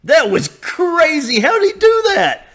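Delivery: high, astonished exclamations; amazed. Real, not acted. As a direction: blown away, voice leaping up. Amazed by the coach's demo.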